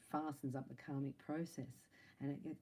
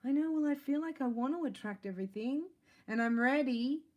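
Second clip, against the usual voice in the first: higher, much louder eager rush; eager declaration; 9 semitones higher, 9 dB louder than usual. Retold or imitated. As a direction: say it like you're finally ready. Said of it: "And you're like".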